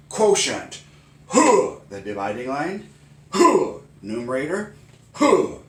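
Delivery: loud terms each with a high karate huh; karate chant. Shown as a demonstration. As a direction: shout each word then grunt like a karate strike. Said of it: "in slow motion for slow learners".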